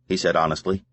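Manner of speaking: ordinary delivery, nothing marked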